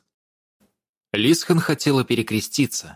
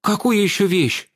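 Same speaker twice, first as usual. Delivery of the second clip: puffing; 9 semitones higher than usual. A narrator's reading. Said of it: "пропыхтела Лисхен".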